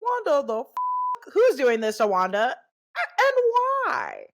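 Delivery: high, slow, bright TV-mom delivery; eighties sitcom voice. Pretend. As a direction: play it like a cheesy eighties sitcom. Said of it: "This is my eighties sitcom voice".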